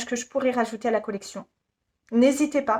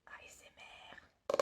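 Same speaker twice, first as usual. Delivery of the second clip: mock ASMR whisper; 23 dB quieter, faster than usual. Jokey ASMR aside mid-review.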